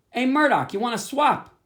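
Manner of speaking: called out high and loud to a passerby; a kid hollering to a friend down the street; calling out. Acted. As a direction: hail him across the sidewalk, bright and high. Chester calls out to passing Murdoch.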